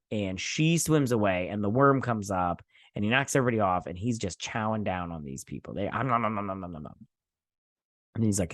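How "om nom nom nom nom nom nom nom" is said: fast mock munching sounds; chomping noise. Vlogger voicing the worm eating people, mock munching. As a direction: mimic a monster gobbling.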